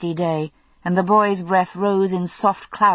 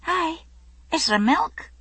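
put-on small child voice; 11 semitones higher than usual. A narrator's reading. Small boy asks for milk.

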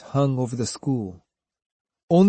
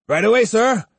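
quick obedient reply, higher pitched; eager followers; 8 semitones higher, 4 dB louder than usual. Acted. Two followers answering their master from the shrine, eagerly. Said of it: "they replied in unison".

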